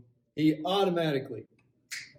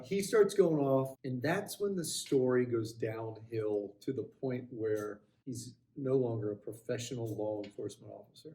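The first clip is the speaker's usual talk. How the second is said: plain reading voice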